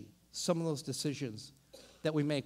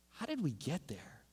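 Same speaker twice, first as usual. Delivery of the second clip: ordinary talk, nothing marked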